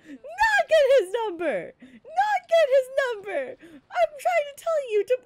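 loud high cry of protest; shouting down a sister's bad idea; exasperated yell. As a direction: bellow it in disbelief. Brother yelling in skit.

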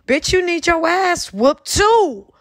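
angry. Real, not acted. Venting at TJ for letting Jag run over him, slow angry. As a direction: drag each word out in real anger. Ranting at TJ on stream.

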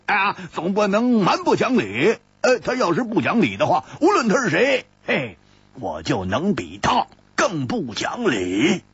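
a hint of blustering bravado; defiant boast. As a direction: challenge anyone to out-argue you. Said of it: "大声地说".

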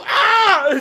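high, loud playful scream; zombie-like scream. Pretend. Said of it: "You just screamed like you'd be a zombie".

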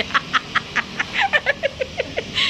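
very high, squealing laugh; squealing giggle; real high laugh. Real, not acted. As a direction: shriek with laughter. Laughing at Balinese warning.

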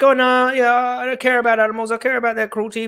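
high mock voice of a meat eater; high, mocking caricature voice; mocking hypocrite. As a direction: sneer it out as the excuse-maker.

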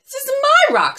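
very high, loud little turtle voice; high-pitched, scolding turtle; indignant turtle. Acted. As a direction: squeak it out, affronted and territorial. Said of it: "said the turtle".

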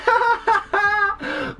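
high-pitched delighted cackle; long, high giggling laugh. Real, not acted. Laughing at the emote.

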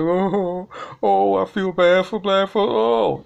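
mock crying. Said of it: "hear this turkey be like".

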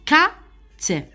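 كات stretched out slowly, k then long a then t; slow demo. Shown as a demonstration. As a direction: spell out كات very slowly for kids. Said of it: "قط نقراوه". (كات ببطء شديد كأنك تعلم الأطفال)

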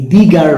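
put-on "the girl" with the said "dee"; emphatic "thee". Shown as a demonstration. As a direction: stress "the" in "the girl", rhyming it with see. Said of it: "গুরুত্ব দেওয়া".

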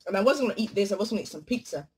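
usual speaking voice

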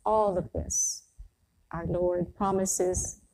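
ordinary talk, nothing marked

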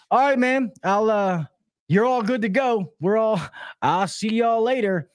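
high-pitched, halting sign-off; awkward exit. Retold or imitated. Technician leaves a quarrelling couple, high awkward goodbye. Technician excusing himself from a fight.

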